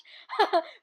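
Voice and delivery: a short, loud laugh that falls away; real laugh. Real, not acted. Watching her own clip, a loud real laugh.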